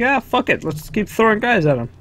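high, careless mock voice of the devs; mock game developers. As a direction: toss it off like a lazy designer shrugging. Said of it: "I guess they're just like".

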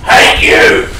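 loud, breathy yell; shouting. Real, not acted. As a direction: snap it at the screen. Yelling at game.

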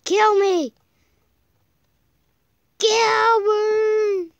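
high, loud despairing cry; desperate yell. Real, not acted. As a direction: howl it out in despair. Desperate in game.

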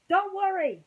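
high, loud reassuring yell. Acted. Ham yelling from the top of the stairs, high and loud. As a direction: shout it down the stairs. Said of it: "Ham yelled from the top".

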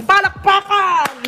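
high, drawn-out call for applause; rousing call. Real, not acted. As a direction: call out to the crowd, stretching it high. Calls viewers to applaud. (pasigaw na panawagan ng palakpakan)

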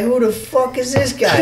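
high-pitched mock-baffled buddy impression; Joe Kidd accent. Buddy asks about the stranger, high put-on accent. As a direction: mimic your friend's accent, way up high. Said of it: "That's a pretty good Joe Kidd accent, too".

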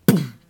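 short, loud boom; impact boom. A narrator's reading. Goat rams the troll, loud boom. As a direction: blurt a sudden loud thud.

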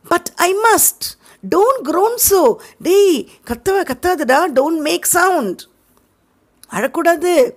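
put-on high, fretful boy's voice; worried boy. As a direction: hush him anxiously. Sid frets over Tom. (கவலைப்பட்ட உயர்ந்த சிறுவன் குரல்)

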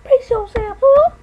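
very high squeaky cry. Acted. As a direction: squeak it out like a cartoon. Same line redone squeaky.

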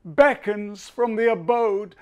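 solemn, soaring poetry reading; poem recitation. Acted. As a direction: declaim it like a grieving bard, building to the final stanza. Reads Adonais stanzas aloud.

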